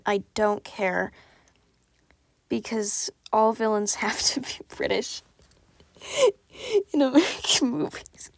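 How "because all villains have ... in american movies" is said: breaking into giggles mid-sentence; laughing. Real, not acted. Reader justifying a British villain, laughing. Amused at own accent choice.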